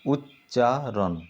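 উচ্চারণ stretched out syllable by syllable; slow syllable split. Shown as a demonstration. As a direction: draw out উচ্চারণ to show its syllables. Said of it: "দল বিশ্লেষণ করলে হবে". (উচ্চারণ শব্দটি টেনে টেনে দলে ভেঙে বলা)

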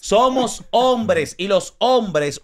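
arrastra cada palabra con peso; lento enfático. Real, not acted. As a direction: dilo despacio, marcando la verdad. (muy despacio y con énfasis)

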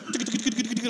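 quick tongue-rattle of feet pounding; imitated running feet noise. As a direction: rattle out Barney Rubble's feet powering the car. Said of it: "like that".

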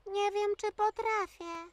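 small, soft voice; shy, unsure. Acted. Shy newcomer doubts she can take her turn, soft and hesitant.

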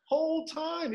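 high, slow, emphatic exasperation; exasperated insistence. Real, not acted. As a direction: stretch it out as the painfully obvious. Exasperated answer to surprised people.

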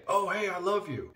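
high hammed-up sweet talk; mock sweet wife. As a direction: coo it like she's reeling him in. Mocking wife luring him back.

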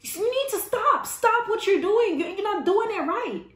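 high, loud outraged scolding; scolding salon owner. Retold or imitated. Salon owner scolding the stylist, high and outraged. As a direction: scold her sharply, pitched high. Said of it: "the salon owner was like".

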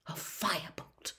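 breathless hush of wonder; awed whisper. Acted. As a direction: breathe the word like a secret. Wood savours the thought.

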